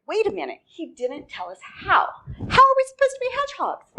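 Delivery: high, squeaky, rising mock panic, quickening at the end; panicked mice. Mice realizing the owl never told them how, squeaky panic. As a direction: climb into a frantic squeak.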